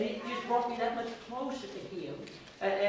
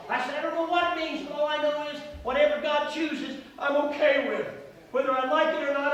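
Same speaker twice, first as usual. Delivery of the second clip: louder, higher impassioned preaching; preaching at full force; 6 semitones higher, 6 dB louder than usual. Acted. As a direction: preach it out, building to a cry.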